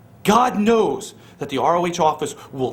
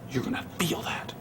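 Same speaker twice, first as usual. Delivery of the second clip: much softer and lower, hissed threat; menacing whisper; 4 semitones lower, 13 dB quieter than usual. Acted. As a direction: whisper it like a promise of pain. Threat to his rival.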